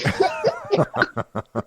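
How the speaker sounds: cracking up after a silly impression; soft burst of laughter, trailing off; real laugh. Real, not acted. Podcaster laughing at own movie impression, amused laugh.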